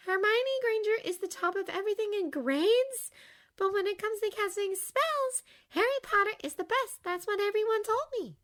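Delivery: put-on high girl's voice; young girl voice. A narrator's reading.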